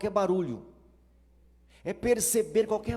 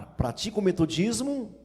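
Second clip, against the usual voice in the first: normal talking voice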